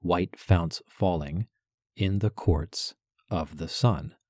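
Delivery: slow, pause after "falling", stress "courts" and "sun"; phrased in groups. Shown as a demonstration. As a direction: break the line into beat groups. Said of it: "break it up that way".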